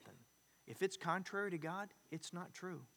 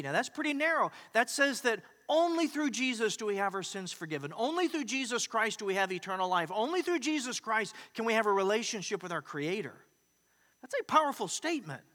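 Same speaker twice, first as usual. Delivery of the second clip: louder, higher preaching push; rising preaching; 5 semitones higher, 5 dB louder than usual. Acted. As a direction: drive the point home to the congregation. Preacher drives home the point.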